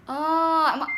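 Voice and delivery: high, loud "oh" of sudden realization; the penny drops; realizing the event was for a TV show. Retold or imitated. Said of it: "I was like".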